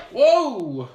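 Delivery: real startled exclamation; surprised whoa. Real, not acted. Surprised during assembly.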